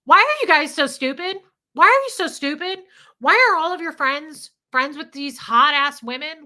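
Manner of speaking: high, loud, fed-up shouting; exasperated yelling. Retold or imitated. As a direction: yell it at him in disbelief. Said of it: "And I would go".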